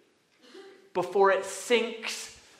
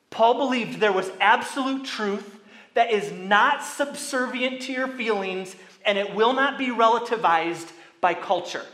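higher and louder, driving through each verse; preaching at full force; 5 semitones higher, 8 dB louder than usual. Acted. Preacher warns the church about false teaching, fervent and loud. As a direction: hammer the references like a revival preacher.

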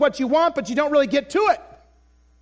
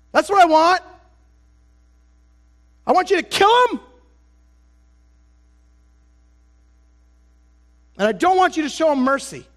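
[name] demanding; 2 semitones higher, 3 dB louder than usual. Pretend.

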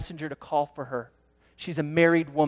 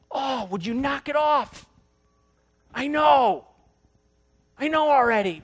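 exasperated whine; 9 semitones higher, 5 dB louder than usual. Pretend. Sinner pushing back at God.

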